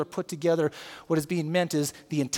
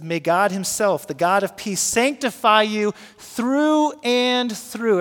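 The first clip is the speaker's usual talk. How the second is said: louder, slow, stress on through; emphatic reading; 4 semitones higher, 6 dB louder, slower than usual. Shown as a demonstration. Pastor reading the verse aloud, slow and emphatic. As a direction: draw out through and through. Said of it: "it says".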